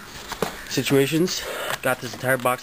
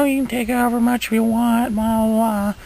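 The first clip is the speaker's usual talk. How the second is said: much higher mock voice of the woman selling; imitating the seller lady; 12 semitones higher, 3 dB louder than usual. Retelling the seller woman's offer in a high mock voice. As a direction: play the older lady, pitched way up.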